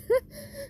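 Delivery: a short high-pitched giggle. Retold or imitated. Deku laughing at the confused class, high giggle. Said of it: "starts laughing".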